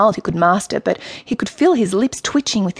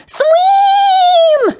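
very high, loud drawn-out yell; 8 dB louder than usual. A narrator's reading. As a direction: shriek a panicked warning to swim. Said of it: "yelled Michael".

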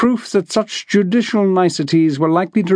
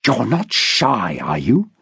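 hissed; 6 semitones lower, slower than usual. A narrator's reading. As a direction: let it drop low and slow like a threat. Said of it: "Harry hissed".

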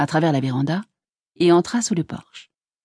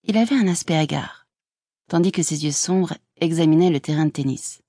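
ordinary delivery, nothing marked

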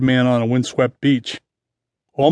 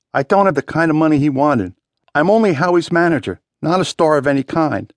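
pleading excuse; 3 semitones higher than usual. A narrator's reading. Wounded man pleading his excuse.

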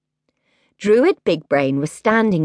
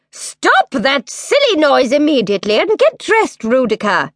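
stern mother queen; 8 semitones higher, 3 dB louder than usual. A narrator's reading. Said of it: "she said".

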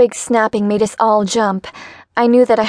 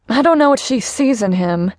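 annoyed. Acted. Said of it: "Louise sounded annoyed".

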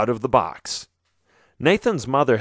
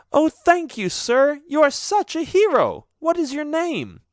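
put-on a high young girl's voice, thankful; gushing thanks from a girl just untied; rescued girl. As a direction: lift high into the grateful maiden.

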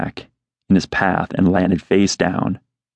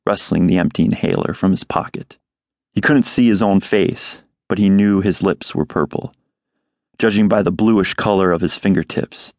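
ordinary delivery

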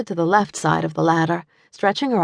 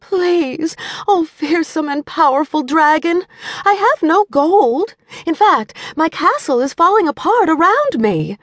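high, loud pretend damsel fear; theatrical mock plea to the dragon; mock frightened; 12 semitones higher, 3 dB louder than usual. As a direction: play the helpless maiden, hammed up. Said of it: "Doing her best to appear frightened".

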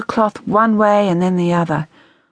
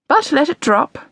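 mother's snappish line; 11 semitones higher than usual. A narrator's reading. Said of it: "she said".

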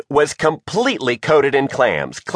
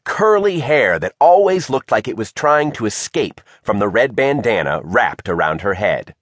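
plain reading voice

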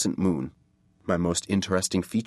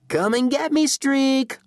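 high, loud playground taunt; 4 dB louder than usual. A narrator's reading. Kid up a tree goading the brother below, taunting. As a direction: call it out high, daring him. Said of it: "the name was taunt enough".

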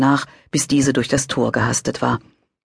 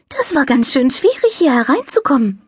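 high, light angel voice; little angel voice; 12 semitones higher than usual. A narrator's reading. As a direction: play a cheerful little angel. Angel character voice.

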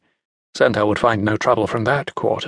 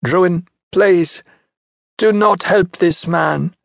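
pleading mother; 6 semitones higher, slower than usual. Acted. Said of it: "the woman said to her son".

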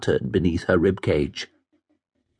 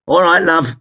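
higher-pitched, chirpy cabbie voice; 7 semitones higher, 4 dB louder than usual. Acted. Cabbie greets drunk fare, breezy. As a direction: pipe up as a London cabbie.

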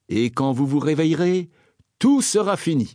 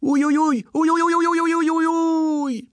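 pained moaning. Acted. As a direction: whimper like a sick prince.

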